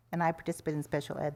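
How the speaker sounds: ordinary talk, nothing marked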